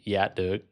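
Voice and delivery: "Dạ được" with "Dạ" said as "yah"; Southern pronunciation. Shown as a demonstration. Learner shows the Southern way to say it, y-sound pronunciation. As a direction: open "Dạ" on a y sound, not z. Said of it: "the way you would say this in Southern Vietnamese".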